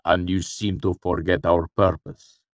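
cool voice with an edge; measured rebuke, lightly sharpened; cool, sharpening. A narrator's reading. Shadowed man rebuking Cinder, cool and sharpening. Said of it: "his cool voice sharpening".

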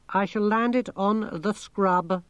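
high, monotone computer voice; talking machine, matter-of-fact. A narrator's reading. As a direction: report it evenly like a machine.

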